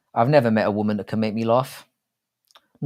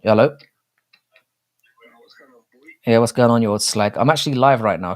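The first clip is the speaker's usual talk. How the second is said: louder, chummy phone banter with his brother; loud phone call; 2 semitones higher, 6 dB louder than usual. Real, not acted. Streamer answering his brother's call, loud and matey. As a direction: call it out loud like the line is bad.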